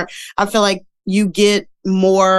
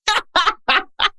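high laugh; 13 semitones higher than usual. Real, not acted. Laughing after IBS admission.

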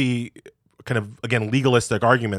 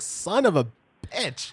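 exasperated curse; 7 semitones higher, slower than usual. Retold or imitated. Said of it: "we're just here in the office like".

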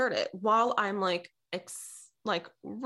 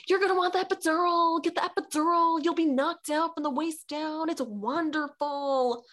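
high, fast, hyped-up mimicry of friends; imitating over-eager friends; gushing friends' advice; 8 semitones higher than usual. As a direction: gush it high, stretching the last words. Acting out friends urging the epidural.

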